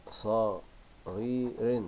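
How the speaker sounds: "صغير" slowly split into its syllables; very slow, separated "صغير". Shown as a demonstration. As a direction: dictate "صغير" piece by piece, with gaps. Dictates word while writing.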